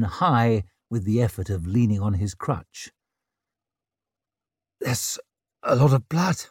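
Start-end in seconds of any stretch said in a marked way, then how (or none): 4.8-6.5 faint, halting croak; croaked alarm. A narrator's reading. Father at the bedside seeing blood, stunned croak. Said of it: "he croaked, eyes wide".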